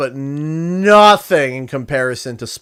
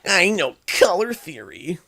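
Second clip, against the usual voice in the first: Bubble Bass voice; 5 semitones higher than usual. Acted. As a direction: whine it out like a smug cartoon fish. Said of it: "since I did the Bubble Bass voice".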